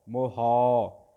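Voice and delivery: ਮੋਹਾ with a short ਮੋ and a loud, drawn-out ਹਾ; ਮੋਹਾ, short first vowel, long second; long second syllable. Shown as a demonstration. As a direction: stretch the ਹਾ of ਮੋਹਾ long and full. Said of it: "ਕਨੌੜਾ ਲੰਬਾ ਕਰਕੇ ਪੜ੍ਹਾਂਗੇ".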